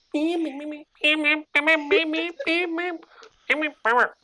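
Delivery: high squeaky flea chatter; squeaky flea gibberish. Acted. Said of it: "my flea voice".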